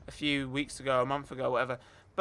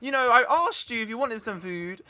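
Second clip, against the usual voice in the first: loud, high mock outrage; indignant refusal; 5 semitones higher, 4 dB louder than usual. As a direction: tell her off in hammed-up indignation. Acted quarrel over food.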